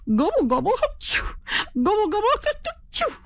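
high gobble broken by a sneeze; gobble, gobble, achoo with a high squeaky sneeze; gobbling sneeze. Acted. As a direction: gobble then sneeze comically. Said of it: "The dusty road made him sneeze".

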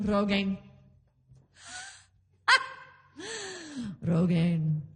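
mock restraint, word squeezed out after a laugh; holding it in. Dad staring at his Christmas gift, lightly acted restraint. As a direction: pretend to contain yourself. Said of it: "trying to hold it all in".

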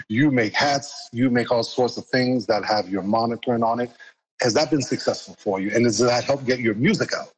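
normal talking voice

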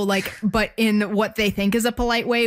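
ordinary talk, nothing marked

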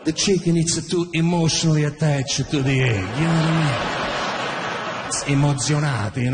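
dad lecturing his son with grave certainty; Italian dad explaining. Retold or imitated. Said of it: "It's like".

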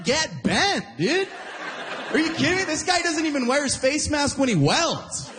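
high-pitched, incredulous protest; comic indignation. Acted. Mock indignation about telling Gary.

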